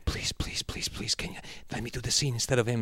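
breathy whispered pleading; imitating Nacho begging. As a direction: beg under your breath.

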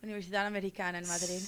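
fast, native Castilian Spanish. Shown as a demonstration. Asked about college, she shows off her Castilian Spanish. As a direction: rattle it off in Spain's Spanish. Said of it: "where did you go to college?".

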